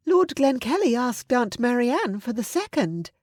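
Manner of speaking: put-on high-pitched, guileless young lady; innocent. A narrator's reading. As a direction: pipe up innocently with a bit of news. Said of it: "Diana said innocently".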